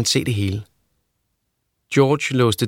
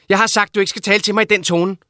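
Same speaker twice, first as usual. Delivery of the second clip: mother's voice raised in a quarrel; angry mother; 9 semitones higher, faster than usual. A narrator's reading. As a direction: snap back at her son.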